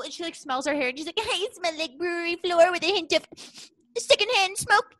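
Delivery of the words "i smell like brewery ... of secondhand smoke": very high put-on baby voice.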